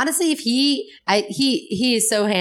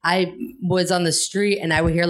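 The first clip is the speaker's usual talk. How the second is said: normal talking voice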